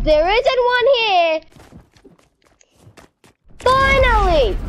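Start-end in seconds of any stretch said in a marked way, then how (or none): 3.6-4.6 high, exclaimed relief; real shout of exasperated relief; relieved outburst. Real, not acted. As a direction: cry out as the jump finally works. Relief after failing a jump repeatedly.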